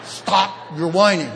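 loud, slow, half-laughing parent scolding; a fed-up parent snapping at kids, played for laughs; mock exasperated parent. Preacher mimicking a parent scolding kids in the car, mock exasperated. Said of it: "Parents, have you ever said".

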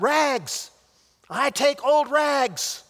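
high, loud, slow drawn-out call; sobbing cry. A narrator's reading. Bleeding rag man calling for old rags, loud. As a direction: call out through the hurt. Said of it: "cried the sobbing, bleeding rag man".

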